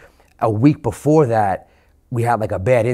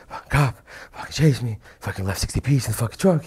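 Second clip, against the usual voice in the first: retold breathless. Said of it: "I can barely speak to him".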